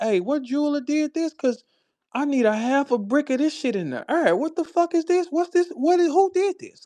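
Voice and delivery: high-pitched, astonished questioning; excited curiosity. Retold or imitated. Comic asking her who made the diamonds, high excited curiosity. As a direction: pile up the questions in a high, amazed voice.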